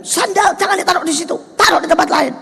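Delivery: high-pitched, quick mock telling-off; fierce scolding, imitated. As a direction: imitate someone crossly ordering people about. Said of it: "Dia bilang gitu, gualanya itu loh".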